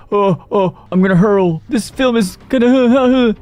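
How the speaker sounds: high-pitched fake retching voice; hammed-up nausea; mock queasy. Acting out nauseous moviegoer about to vomit.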